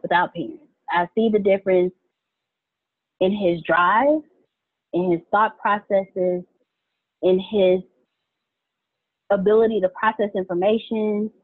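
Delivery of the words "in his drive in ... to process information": slow deliberate listing. Real, not acted. Listing his strengths.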